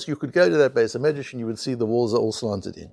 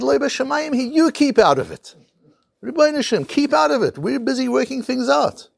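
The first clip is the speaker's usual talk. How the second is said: angry rebuke; 10 semitones higher than usual. Retold or imitated. Rabbi Yeshua telling the heavenly voice to keep out, high angry rebuke. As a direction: shoo the heavenly voice away indignantly. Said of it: "he gets angry with the".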